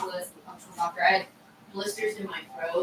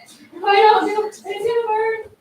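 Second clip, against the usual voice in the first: panicky; 8 dB louder than usual. Retold or imitated. Quoting her own anxious overmixing.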